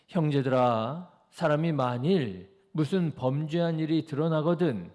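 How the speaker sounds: loud, measured Bible reading; scripture reading. Acted. Preacher leading the congregation in reading a verse, loud scripture reading. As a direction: read the verse out firmly for all to follow.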